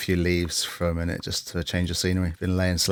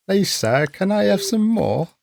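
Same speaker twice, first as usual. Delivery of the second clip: Oliver Twist voice; 13 semitones higher than usual. Retold or imitated. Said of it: "Oliver Twist taking the twist".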